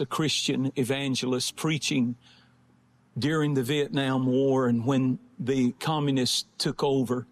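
low-pitched, measured testimony with long pauses; sober sermon storytelling. Real, not acted. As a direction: tell the story gravely, pausing between phrases. Tells illustrative story in sermon.

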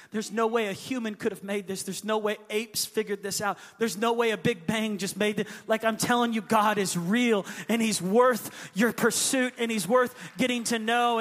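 loud, rising preacher's cry; impassioned pulpit declaration; preaching at full force. Real, not acted.

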